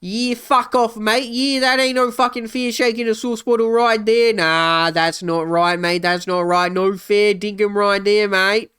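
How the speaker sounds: hammed-up Australian bloke; mock Australian accent. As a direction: play a cheeky Aussie telling someone off. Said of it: "I'm Australian".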